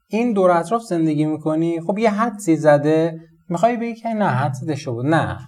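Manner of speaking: usual speaking voice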